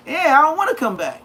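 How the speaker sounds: loud, high, insistent vow; emphatic promise. Retold or imitated. Telling the guards he won't come back, loud. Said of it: "I used to tell them just like that".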